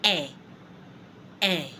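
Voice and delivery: en as a short nasal "an"; French nasal vowel. Shown as a demonstration. Teacher showing how to say I N, French nasal vowel. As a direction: en through the nose with silent N. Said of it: "to say I N in French".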